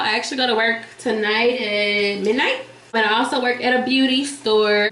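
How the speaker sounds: normal talking voice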